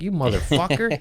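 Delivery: high-pitched mock outrage; half-joking angry outburst; retold anger. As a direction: re-enact cursing out a friend. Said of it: "First day was anger".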